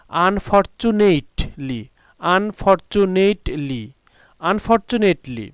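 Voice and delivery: আনফরচুনেটলি drawn out slowly, syllable by syllable; teacher modelling আনফরচুনেটলি slowly; model pronunciation. Shown as a demonstration. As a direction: stretch আনফরচুনেটলি slowly for students. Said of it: "উচ্চারণগুলো জেনে নেব".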